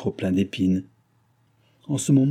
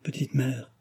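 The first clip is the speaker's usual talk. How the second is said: soft, weak, higher murmur; whisper; 4 semitones higher, 6 dB quieter than usual. A narrator's reading. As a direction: breathe it out faintly, dying. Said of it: "Il chuchota entre ses lèvres blanchies".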